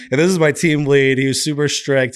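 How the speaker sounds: ordinary talk, nothing marked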